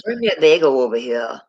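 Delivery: playful mock Brooklyn accent. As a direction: imitate a New York local. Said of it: "Brooklyn-like accent".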